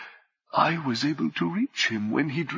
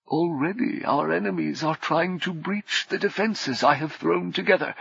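ordinary delivery, nothing marked

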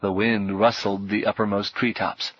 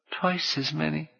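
high and much softer, fading away; trailing off; 6 semitones higher, 6 dB quieter than usual. A narrator's reading. Boy echoes the number, trailing off. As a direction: let the words die away. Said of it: "His voice trailed off".

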